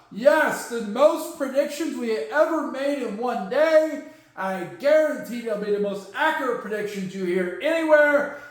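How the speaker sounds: ordinary talk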